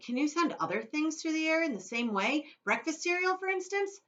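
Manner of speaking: put-on high, loud, eager boy; boy pressing Wonka with a keen question; excited shout. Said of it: "he shouted".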